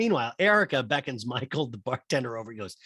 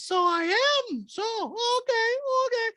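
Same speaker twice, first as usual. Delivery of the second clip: very high, slow mocking falsetto; Diana; slower than usual. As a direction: mimic her defiant retort, stretched and squeaky. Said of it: "Diana goes".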